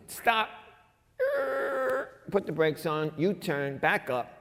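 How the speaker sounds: sudden high cry of stop, then settling; urgent call to stop. Acted. God calling the prophet back to the king, urgent high cry. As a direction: call him back before he leaves.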